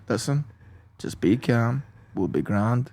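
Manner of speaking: low-key, soft reassurance, retold; stoic, calming. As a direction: play the calm savior, keeping the volume down.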